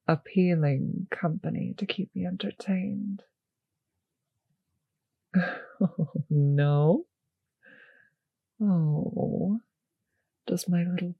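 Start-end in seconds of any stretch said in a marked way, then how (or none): 5.3-6.2 short amused chuckle, falling away. Acted. Vampire teasing silent captive.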